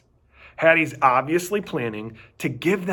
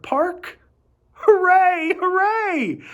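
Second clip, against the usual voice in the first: excited cheer; 4 dB louder, slower than usual. A narrator's reading. Dog thrilled about dog park.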